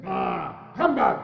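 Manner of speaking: angry humbug. Acted. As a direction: spit out the dismissal. Scrooge dismisses Merry Christmas.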